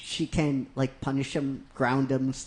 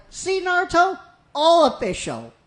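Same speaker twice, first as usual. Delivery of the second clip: smug Ino; 14 semitones higher, 5 dB louder than usual. Retold or imitated. Ino gloats in retold scene.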